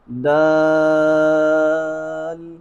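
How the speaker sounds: دال stretched long and held; drawn-out dal. Shown as a demonstration. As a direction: hold دال long and firm. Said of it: "شدت کے ساتھ اسے ادا کرنا ہے". (دال لمبا کھینچ کر)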